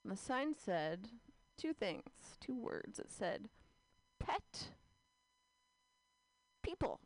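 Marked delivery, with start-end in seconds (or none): 4.2-7.0 higher pitched. Acted. Reading the sign.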